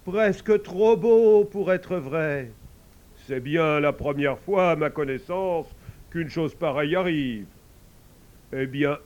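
put-on a kindly old priest advising; touched pope giving counsel. Pope's voice held.

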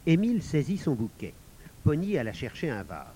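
plain reading voice

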